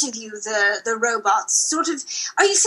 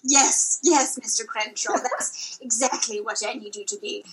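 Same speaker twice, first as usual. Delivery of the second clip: ordinary talk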